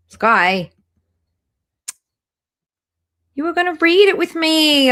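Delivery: loud, high call across the house. Real, not acted. Host calling to absent friend Sky, loud and high. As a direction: shout for Sky, then plead.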